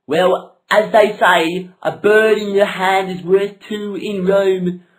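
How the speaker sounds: slow loud mock-wise saying; pompous proverb. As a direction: deliver the proverb like a sage. Pompous proverb delivered grandly.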